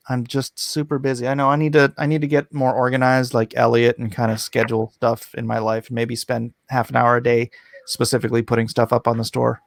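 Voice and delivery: ordinary delivery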